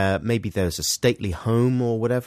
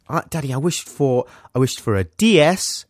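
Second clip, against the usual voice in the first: put-on higher, emphatic child voice; six-year-old son; 4 semitones higher, 5 dB louder than usual. Son telling Daddy what he wished for, emphatic child voice. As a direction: list demands like an eager small boy. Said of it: "He said".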